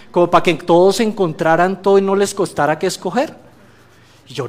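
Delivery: ordinary delivery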